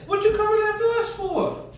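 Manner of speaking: quick, high acted jeer; taunting raiders. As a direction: snap it back fast like a bully. Danites taunt Micah again.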